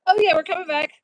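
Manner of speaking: loud, high relieved call; relieved exclaim. Real, not acted. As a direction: burst out in relief. Stream reconnecting, relieved exclamation.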